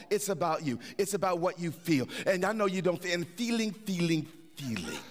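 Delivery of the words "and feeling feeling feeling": repeated word fading to a hush; fading repetition. Shown as a demonstration. Repeating feeling, fading away.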